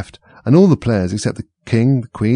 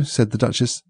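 plain reading voice

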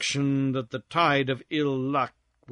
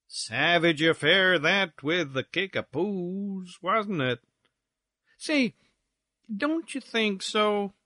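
slow, languid gossip; drawn-out, sliding gentleman's drawl; drawled syllables; 6 semitones higher, slower than usual. Acted. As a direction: drag out each syllable. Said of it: "drawling out his syllables".

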